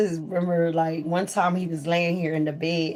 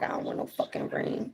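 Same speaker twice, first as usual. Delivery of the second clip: retold whisper; 8 dB quieter than usual. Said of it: "So I was like".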